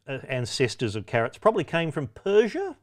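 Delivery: ordinary talk, nothing marked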